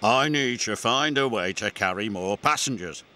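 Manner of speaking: ordinary delivery, nothing marked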